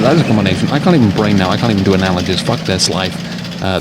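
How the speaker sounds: ordinary delivery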